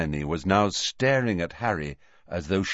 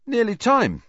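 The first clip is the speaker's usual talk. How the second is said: high, loud, blithe remark; breezy, pitched-up announcement; 14 semitones higher, 5 dB louder than usual. A narrator's reading. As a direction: toss it off cheerfully. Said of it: "said Harry blithely".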